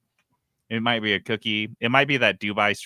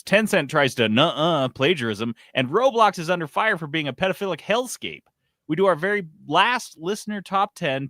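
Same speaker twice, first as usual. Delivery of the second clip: big, loud, higher announcer opener; show intro read; 7 semitones higher, 7 dB louder than usual. Acted. Host to listeners, hyped announcer rundown. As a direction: pump up the episode rundown like a radio host.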